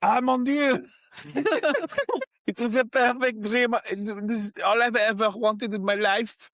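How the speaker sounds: high, excited French chef voice. Acted. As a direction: gush like a delighted French chef. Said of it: "he's like".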